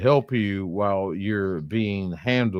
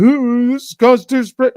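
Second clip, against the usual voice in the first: much higher, louder, fast mocking squeal; mock scream; 9 dB louder than usual. Streamer mocks sovereign citizens screaming the Constitution, high fast squeal. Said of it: "They love to scream".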